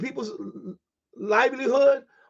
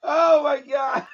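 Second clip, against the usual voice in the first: gasping through the laugh; laughing fit; 4 semitones higher, slower than usual. Real, not acted. Cracking up at the news to viewers, laughing through words.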